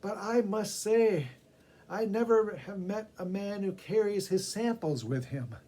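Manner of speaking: man mocking the preacher's hot sauce; dry punchline. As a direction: land the joke deliberately.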